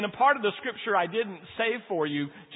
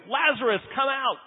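higher and louder, calling into the tomb; 7 semitones higher, 3 dB louder than usual. A narrator's reading. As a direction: call Lazarus out with a raised voice. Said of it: "he said in a loud voice".

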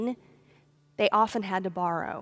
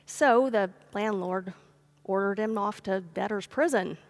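usual speaking voice

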